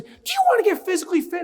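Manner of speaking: very high, loud, hyped-up question; hammed-up motivator; acted rallying call. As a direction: whip the group up as an eager researcher. Researcher rallying second group.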